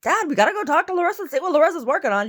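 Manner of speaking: be the excited little dog; voicing the dog. Goldie the dog urging his dad, high eager voice. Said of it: "Goldie comes running in, like".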